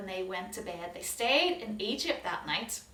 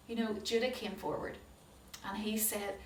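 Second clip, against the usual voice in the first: plain reading voice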